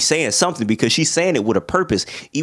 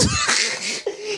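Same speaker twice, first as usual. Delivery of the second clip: high wheezing laugh; 6 dB quieter than usual. Retold or imitated. Laughing in teacher voice.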